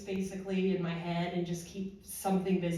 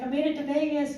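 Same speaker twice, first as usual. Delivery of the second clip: lightly chirpy, raised pitch; upbeat retold news; 8 semitones higher, 4 dB louder, faster than usual. Telling a work friend of the trip, upbeat. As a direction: share the good news in a high voice.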